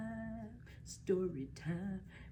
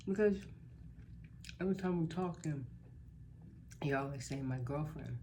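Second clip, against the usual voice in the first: emphatic explaining; 3 semitones higher, faster than usual. Retold or imitated. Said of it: "She said".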